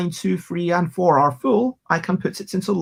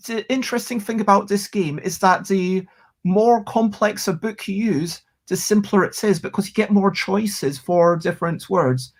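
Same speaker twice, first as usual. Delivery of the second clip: ordinary talk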